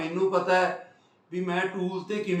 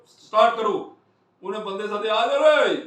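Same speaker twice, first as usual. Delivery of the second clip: loud, higher retold shouts; boss yelling orders; 3 semitones higher, 7 dB louder than usual. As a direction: bark it like a hurried boss summoning workers. Boss yelling orders and calling men.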